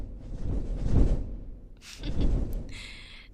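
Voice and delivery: a light, easy laugh. Acted. Trainer reassuring nervous rider about dragons, chuckling.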